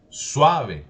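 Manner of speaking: "Suave" with a loud, high A; emphatic demonstration of SUAve, first syllable punched; stress on the A. Shown as a demonstration. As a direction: hit the A in "Suave" hard, then drop. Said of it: "como si tuviera el acento en la A".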